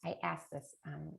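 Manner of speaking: ordinary talk, nothing marked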